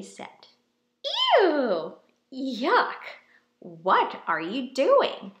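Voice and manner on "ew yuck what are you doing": loud grossed-out "Ew! Yuck!"; disgusted mole rats. Acted.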